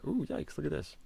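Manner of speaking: jumps up high in real surprise at the blunder; a sudden high yikes, caught off guard; startled yikes. Real, not acted. Chess streamer reacting to an engine blunder warning, startled yikes.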